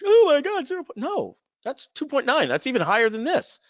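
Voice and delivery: sudden shock at the numbers; shocked outburst. Retold or imitated. Friend shocked by his rate.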